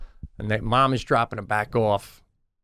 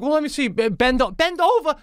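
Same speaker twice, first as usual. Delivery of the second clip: mock doctor; 13 semitones higher than usual. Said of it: "And he's like".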